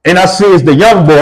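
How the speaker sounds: ordinary talk, nothing marked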